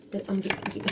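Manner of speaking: ordinary talk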